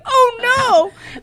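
high, loud laughing "oh no"; mock shocked protest. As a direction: squeal it in laughing disbelief. Retelling her own shocked reply.

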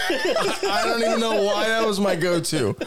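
high, laughing disbelief at yourself; real laughter lifting the voice. Real, not acted. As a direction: crack up while admitting it. Laughs at own absurd excuse.

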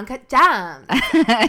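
loud, drawn-out L.A. vocal style; mock L.A. drawl, blasted loud; Julia Fox. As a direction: stretch it out slowly in exaggerated L.A. speak. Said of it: "that's how everybody talks in L A".

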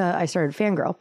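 ordinary talk

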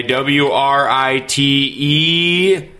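W R I T E spelled out slowly letter by letter. Shown as a demonstration. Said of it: "capitalized italics".

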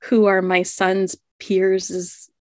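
ordinary talk

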